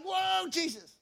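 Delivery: high, drawn-out whoa of amazement; amazed disciples. Acted. Disciples see Jesus walking on water, awestruck.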